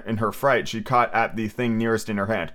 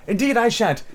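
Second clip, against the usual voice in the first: high, sharp refusal; impatient; 10 semitones higher than usual. A narrator's reading. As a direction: snap back impatiently. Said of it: "rather impatiently".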